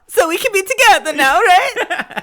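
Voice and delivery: high, loud comic squeal; hammed-up eager sidekick. Joking as Fabrizio popping his head out, high hammed-up cry. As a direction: burst in with an absurdly high mock plea.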